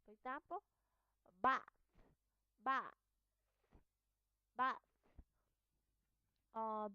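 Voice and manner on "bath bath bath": "bath" ending on a voiceless TH; final TH. Shown as a demonstration. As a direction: drill "bath", letting air out on the final TH. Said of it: "at the end of the word".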